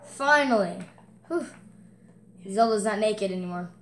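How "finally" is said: ordinary delivery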